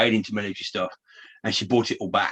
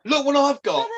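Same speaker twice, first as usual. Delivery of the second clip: put-on much higher, eager voice like a proud child showing a find; excited show-off; 13 semitones higher, 4 dB louder than usual. Imitating her to friends, a proud excited voice. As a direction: pipe it up high, eager to show off. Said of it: "she's like".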